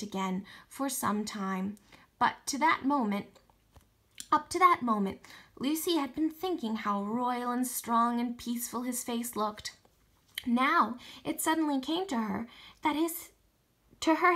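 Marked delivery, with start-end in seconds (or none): none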